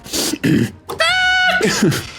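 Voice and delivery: very high chicken-language noise; mock animal call. Shown as a demonstration. Druid speaking to the chicken in its own language, very high noise. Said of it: "doing that towards the chicken in their language".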